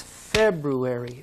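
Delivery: February slow, first part stressed; FEB-ruary, slow and clear; stress on first syllable. Shown as a demonstration. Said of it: "February has the same stress".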